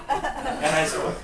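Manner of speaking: ordinary delivery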